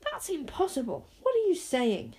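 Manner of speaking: high, protesting disbelief; incredulous. Acted. Wish disputes her friends' account, incredulous.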